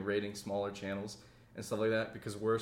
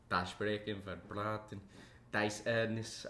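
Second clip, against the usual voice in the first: low-key, quieter mock German; German accent; 3 semitones lower, 8 dB quieter, slower than usual. Shown as a demonstration. Getting into character on request, tentative German accent. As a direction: mumble German-sounding words to find the accent. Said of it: "give me a German accent".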